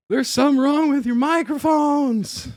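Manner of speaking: high, loud, winded cry of alarm; high-pitched mock breathless yell; mock out of breath. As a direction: imitate a winded man blurting out an urgent warning. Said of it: "He's so out of breath".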